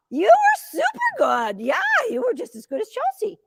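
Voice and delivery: high sing-song praise; praising the dog. Real, not acted. Handler praising her dog after the run in a high praise voice.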